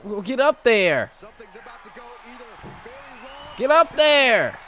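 slow, rising to a high loud yell; building pleading shout. Real, not acted. Begging character to climb.

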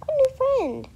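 thrilled little shriek; excited squeal. Real, not acted. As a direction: squeal it with joy. Excited at new friend.

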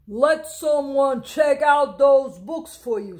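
loud stern officer; policeman. Acted. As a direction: order the boy like a stern policeman. Policeman orders the boy.

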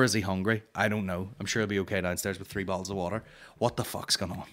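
usual speaking voice

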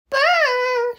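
very high little mouse shiver; teeny tiny voice. Acted. Cold little mouse outside the mitten, teeny tiny voice. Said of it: "in a teeny tiny voice".

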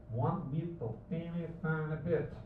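ordinary talk

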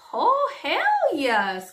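drawn-out delighted "hell yes"; excited cheer. Retold or imitated. Watching two men at the pool, she cheers them on, drawn out and high.

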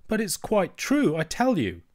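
put-on young princess protesting sincerely; child princess. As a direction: plead to be believed like a small princess. Princess insists earnestly.

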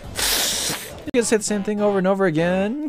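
usual speaking voice

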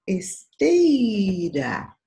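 "esteira" with "tei" drawn out high and loud; ударный слог. Shown as a demonstration. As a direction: stretch and punch "tei" in "esteira". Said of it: "E a sílaba mais forte".

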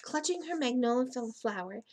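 usual speaking voice

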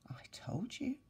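soft, unvoiced whisper; whispered mother. The mother whispers to her son, hushed conspiratorial whisper. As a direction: whisper it like a secret. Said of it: "said the boy's mother".

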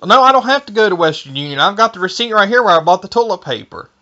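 ordinary talk

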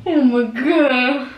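drawn-out slow shock; shocked gasp. Real, not acted. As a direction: stretch it out in disbelief. Reacting to mom catching them.

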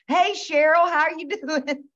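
high, loud call from a passing car; friendly holler. Retold or imitated. Friend calling from a passing car, high cheery holler. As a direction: yell a cheery hello out the car window. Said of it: "somebody hanging out the window going".

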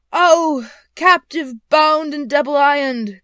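loud, high, drawn-out phantom wail; anguished cry. A narrator's reading. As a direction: cry out slowly as a tormented ghost. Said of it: "cried the phantom".

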